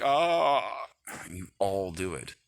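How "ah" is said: mock panic. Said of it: "and panicked, like".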